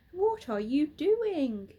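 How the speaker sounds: a worried owl caught off guard; gasped. Acted. Hoot startled by the bear at her nest, gasping. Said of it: "she gasped".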